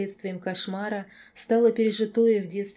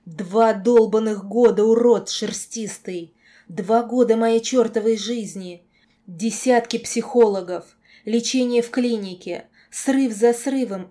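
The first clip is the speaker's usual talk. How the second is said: loud, raised-voice tirade; furious rant; 3 semitones higher, 10 dB louder than usual. A narrator's reading. Girl blaming the werewolf for two years, furious rant. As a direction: hammer every accusation out at full volume. Enraged girl berating the lord.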